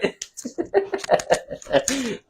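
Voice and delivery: high burst of laughter after the interview. Real, not acted. Broadcaster wrapping the coach interview, laughing.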